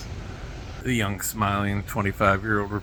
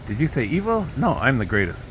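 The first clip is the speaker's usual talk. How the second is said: ordinary talk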